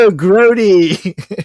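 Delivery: put-on slow, high, loud valley girl "Ew, grody". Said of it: "I'm like".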